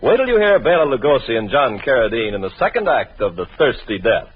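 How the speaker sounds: ordinary talk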